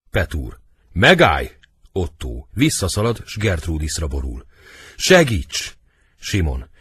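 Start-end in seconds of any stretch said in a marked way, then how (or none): 0.9-1.5 loud stage command; forceful acted shout; shouted halt. 5.0-5.8 high, loud stage plea; acted panicked shout; cry for help. Fleeing prince begs for help.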